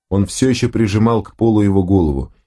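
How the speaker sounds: ordinary delivery, nothing marked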